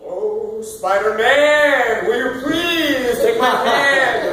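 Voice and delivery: slow, high theatrical Broadway plea; milk it like a Broadway musical number; Broadway style. Shown as a demonstration. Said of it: "Broadway, huge on that shit".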